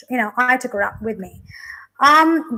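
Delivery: normal talking voice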